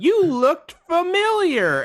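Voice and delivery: very high, slow, loud imitation; mock delighted recognition. Woman recognizing old customer, high drawn-out mock delight. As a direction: squeal it slowly as the excited woman.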